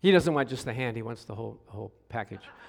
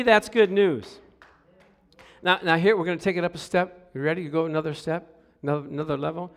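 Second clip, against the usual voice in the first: usual speaking voice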